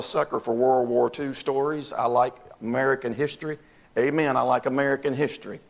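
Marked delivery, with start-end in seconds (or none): none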